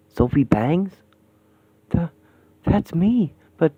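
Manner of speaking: usual speaking voice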